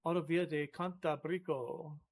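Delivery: "Autovia de Cantabrico" said slowly and softly, feeling out each syllable; careful Spanish pronunciation. Shown as a demonstration. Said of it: "you accent the second to last syllable".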